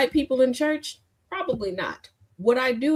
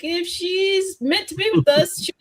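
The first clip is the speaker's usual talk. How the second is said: grandfather voice; 9 semitones higher than usual. Retold or imitated. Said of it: "was like".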